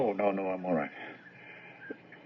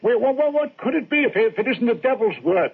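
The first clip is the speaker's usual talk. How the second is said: much higher and louder, panicking; frantic outburst; 10 semitones higher, 7 dB louder than usual. Acted. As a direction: blurt it out in rising terror. Frightened man fearing the devil.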